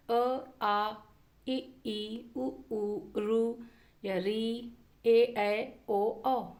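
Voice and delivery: each vowel said apart and clearly, अ आ इ ई; अ, आ, इ, ई spoken one by one like a teacher; reciting vowel sounds. Shown as a demonstration. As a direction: sound out every vowel for the class. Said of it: "स्वर कौन से हैं?".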